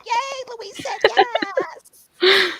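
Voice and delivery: high, slow giddy squeal; a fangirl who got a yes; squealing yay. Retold or imitated. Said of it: "I'm just like".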